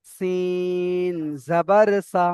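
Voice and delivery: long level "সিন", short "সা"; spelling demonstration. Shown as a demonstration. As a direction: stretch "সিন" slowly, then finish "জাবার সা". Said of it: "তখন এটা সিন হবে".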